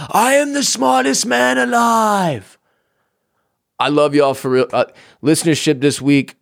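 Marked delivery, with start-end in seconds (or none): 0.1-2.6 very high, slow, triumphant proclamation; mock grandiose shout. Host brags about inventing a segment, mock triumphant shout. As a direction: crow it like a self-declared genius.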